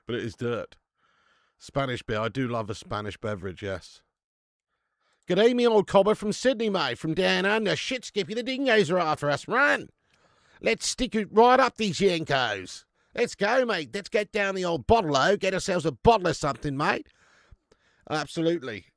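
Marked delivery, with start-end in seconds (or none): 5.3-17.1 high, loud ocker Aussie caricature; hammed-up Aussie bloke shouting at the Yanks; mock Australian. Streamer putting on an Aussie voice for chat, hammed-up.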